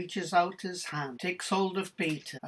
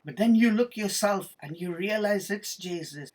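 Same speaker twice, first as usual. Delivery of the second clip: ordinary delivery, nothing marked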